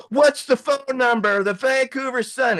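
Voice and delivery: high, sustained imitation of yelling; calling out. Narrator to mentor from pay phones, high urgent call. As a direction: call it across a long line.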